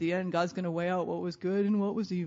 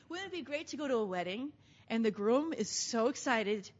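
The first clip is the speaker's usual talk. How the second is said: normal talking voice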